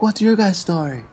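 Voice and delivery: high, squeaky mock interrogation; hammed-up suspicious leader; mock accusing teacher. Imitating the AA teacher accusing them of being drunk, high and squeaky. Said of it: "He's like".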